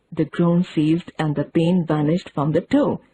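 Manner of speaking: ordinary delivery, nothing marked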